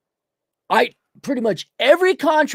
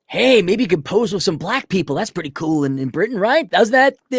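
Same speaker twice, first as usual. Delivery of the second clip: usual speaking voice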